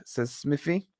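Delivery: ordinary delivery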